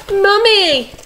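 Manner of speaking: high, drawn-out call falling away; joyful cry. A narrator's reading. Baby owls see their mother return, high happy cry. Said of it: "they cried".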